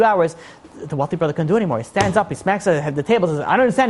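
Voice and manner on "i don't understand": higher-pitched retold protest; frustrated outburst. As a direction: act out a son who can't take it anymore. Acted outburst after smacking table.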